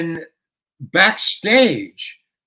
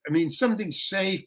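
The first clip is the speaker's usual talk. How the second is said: normal talking voice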